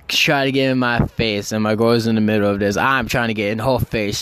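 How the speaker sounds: normal talking voice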